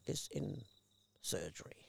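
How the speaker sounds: usual speaking voice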